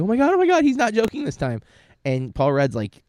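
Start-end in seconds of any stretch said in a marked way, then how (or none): 0.0-1.6 high, loud mock-panicked cry; retold panic. Viewer reliving the choking prank scare, panicked. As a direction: blurt it out much higher, as if fooled by a prank.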